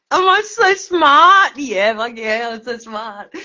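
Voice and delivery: exultant mock-preening; gleeful boast. Real, not acted. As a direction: crow over your own cleverness. Drunk self-congratulation.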